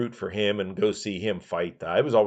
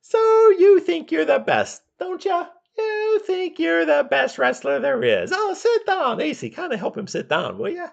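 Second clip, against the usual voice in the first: much higher, slow, sneering host voice; Roddy Piper impression; 4 dB louder than usual. Piper taunting Snuka on his show, high slow sneer. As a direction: talk down to him like a smug bully. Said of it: "he kept telling him".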